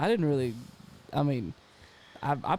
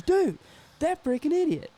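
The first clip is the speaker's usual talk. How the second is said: high-pitched retold exasperation; exasperated roommate; 13 semitones higher, 3 dB louder than usual. Roommate complains about Niall to a bandmate, exasperated. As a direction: act out an indignant friend, voice pitched way up.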